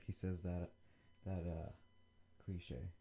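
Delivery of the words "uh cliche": normal talking voice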